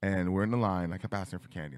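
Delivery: usual speaking voice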